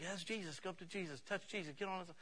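high, soft voice of imitated parents; hushed eager urging. Parents sending little kids up to Jesus, hushed eager urging.

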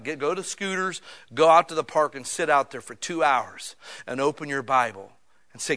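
ordinary delivery

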